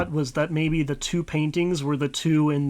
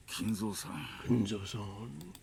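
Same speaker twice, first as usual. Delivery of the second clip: put-on old man voice; 5 semitones lower, 10 dB quieter, slower than usual. Kraus pleading through the door.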